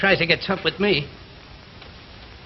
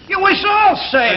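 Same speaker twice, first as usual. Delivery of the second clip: much higher and louder, slow protest; defensive shout; 12 semitones higher, 7 dB louder than usual. Acted. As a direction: throw the blame back at them. Defensive quarrel among workers.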